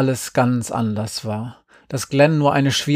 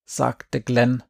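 ordinary delivery, nothing marked

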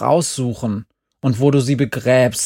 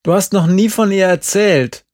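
urgent; 6 semitones higher, 6 dB louder than usual. A narrator's reading. Glenn urgently pushes to leave.

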